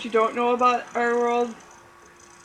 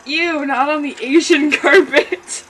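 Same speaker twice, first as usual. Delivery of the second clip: laughing; 4 semitones higher, 7 dB louder than usual. Real, not acted. Reader laughing through line.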